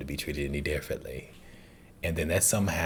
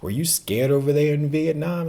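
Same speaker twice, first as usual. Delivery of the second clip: a lightly voiced Jenny, higher and louder; Jenny's question; 10 semitones higher, 8 dB louder than usual. Reviewer voicing Jenny asking Forrest, higher and louder. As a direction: ask it gently but lifted, as Jenny. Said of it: "when Jenny asked Forrest".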